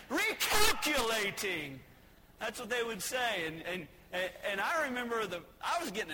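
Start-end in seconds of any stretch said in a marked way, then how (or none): 0.1-1.8 loud, slow, drawn-out mock scream; screaming GPS. GPS furious at another wrong turn, screaming. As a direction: bellow it as a machine gone mad. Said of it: "It was screaming at us".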